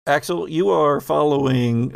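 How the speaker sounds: normal talking voice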